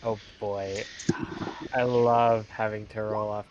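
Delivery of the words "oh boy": ordinary talk, nothing marked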